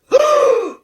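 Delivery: sharp high gasp; shocked gasp. Acted. Stunned by the answer, a sharp high gasp.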